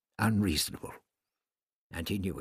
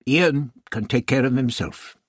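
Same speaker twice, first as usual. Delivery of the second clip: louder, higher, confident dismissal; assuring; 5 semitones higher, 8 dB louder than usual. A narrator's reading. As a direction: brush the worry aside firmly. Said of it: "he assured her".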